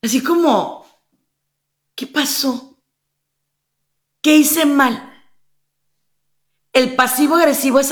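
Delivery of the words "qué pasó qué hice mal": mildly puzzled, slightly higher questioning; a touch of acted confusion; confused victim. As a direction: lightly act out a bewildered victim. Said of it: "deja a la víctima confundida".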